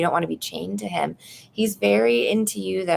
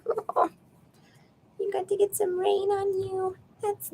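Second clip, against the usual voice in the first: much higher, cooing pet voice; slower than usual. Real, not acted. Talking to her cat Nuggies, high cooing baby talk. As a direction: coo at a beloved cat.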